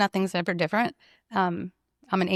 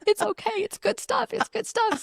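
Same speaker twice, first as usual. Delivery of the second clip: much higher, bright tearful giggle; high giddy reassurance. Retold or imitated. To worried friends after crying, a high giddy reassurance. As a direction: laugh it off through happy tears. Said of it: "And I'm like".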